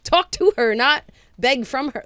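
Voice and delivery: high, loud, fired-up realization; imitating her own sudden realization about Kathy. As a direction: snap back into the character, pumped up. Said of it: "I was like".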